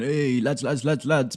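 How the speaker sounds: rally your mates with a boisterous shout; one of the lads. Acted. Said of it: "would it change your personality if you're going".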